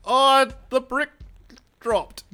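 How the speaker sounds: high, loud put-on impersonation; slow, loud fake voice; Hubba. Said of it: "pretend to be Hubba and go".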